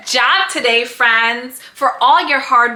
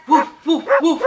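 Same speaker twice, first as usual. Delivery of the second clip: barking like a dog; faster than usual. Pretend. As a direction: play the dog, woof gently. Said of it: "Nice barking like a dog".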